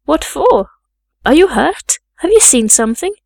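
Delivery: urgent demanding. A narrator's reading. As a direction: demand answers in alarm. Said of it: "again demanded Bessie".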